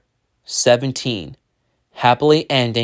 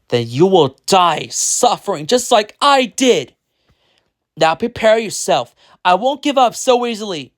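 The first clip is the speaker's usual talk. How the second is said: raised, forceful threatening voice; villainous threat; 7 semitones higher, 3 dB louder than usual. A narrator's reading. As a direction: threaten the hero with punched words. Villain threatens hero in a fight.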